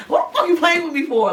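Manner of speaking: imitating her own angry scream at him; furious. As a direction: tell him off in a mock rage. Retold fight with the boyfriend.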